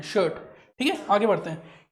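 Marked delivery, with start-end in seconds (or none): 0.0-0.3 शर्ट said shut, no r at all; silent r shirt. Shown as a demonstration. Teacher tells class शर्ट has silent r. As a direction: drop the r in शर्ट, long vowel. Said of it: "आर नहीं बोलना है".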